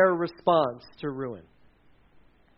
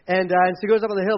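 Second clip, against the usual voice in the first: usual speaking voice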